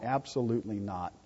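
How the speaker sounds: murmured repeat; quiet echo. Real, not acted. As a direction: drop it down for emphasis. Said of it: "repeated answer".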